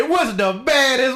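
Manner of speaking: high, slow announcer-style question; radio prankster's catchphrase. Retold or imitated. Prankster asking the victim his show catchphrase, high and drawn out. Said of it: "So he be like".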